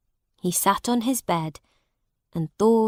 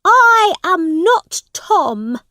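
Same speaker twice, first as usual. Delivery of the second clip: high, loud, slow denial; indignant prince; 10 semitones higher, 9 dB louder, slower than usual. A narrator's reading. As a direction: protest hotly in a high voice. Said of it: "said the prince".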